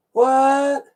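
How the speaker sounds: high, loud sudden surprise; startled what. Real, not acted. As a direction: jump at something unexpected.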